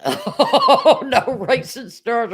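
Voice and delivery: bursts out laughing while reading. Real, not acted. Reading a viewer's comment, laughing hard. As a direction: laugh through the words.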